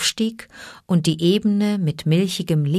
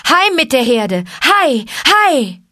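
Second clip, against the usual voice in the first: much higher, louder driving shouts; herding cries; 7 semitones higher, 5 dB louder than usual. Acted. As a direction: drive the buffalo home. Driving the buffalo herd. (Treiberrufe, viel höher und lauter)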